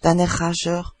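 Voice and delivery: usual speaking voice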